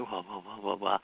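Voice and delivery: low, soft string of whoas; quiet pulsing woofs; imitating dog noises. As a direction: copy the dog's wordless begging sound. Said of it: "we're all gonna be going".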